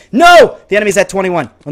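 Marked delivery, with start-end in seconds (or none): 0.1-0.6 sudden high, loud "no"; dismayed shout. Real, not acted. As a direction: yell out in real dismay. Enemy pulls ahead in game.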